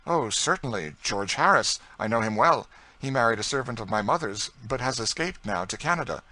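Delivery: hearty, loud recognition; forthcoming young George. A narrator's reading. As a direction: answer readily and heartily, voice lifted.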